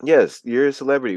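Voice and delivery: usual speaking voice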